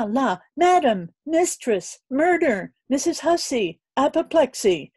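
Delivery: high, loud, breathless maid's babble; a panicked maid blurting it all out; flustered chambermaid. A narrator's reading. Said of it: "And with these cries".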